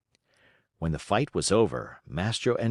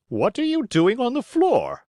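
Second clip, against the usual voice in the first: put-on much higher, puzzled old man; a high little old man asking; Geppetto's voice; 13 semitones higher, 4 dB louder than usual. A narrator's reading. Geppetto asks carpenter on the floor, much higher.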